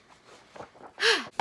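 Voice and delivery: loud exasperated sigh; heavy sigh of frustration; frustrated sigh. Real, not acted. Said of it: "I'm a little bit frustrated".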